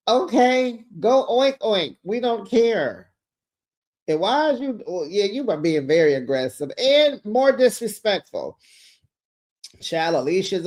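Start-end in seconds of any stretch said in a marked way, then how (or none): none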